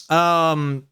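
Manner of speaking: ordinary talk, nothing marked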